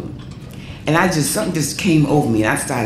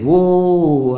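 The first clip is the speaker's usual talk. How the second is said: singer's call; 4 semitones higher, 4 dB louder than usual. Retold or imitated. Singer calls into the mic.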